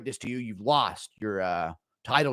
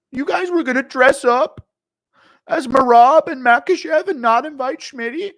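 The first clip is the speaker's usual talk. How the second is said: high, loud offended whine; Schmitty impression; 13 semitones higher, 8 dB louder than usual. Left-out fighter complaining to his friends, high loud offended whine. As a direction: protest like a snubbed friend. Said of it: "impression of Schmitty not being invited to a Halloween party".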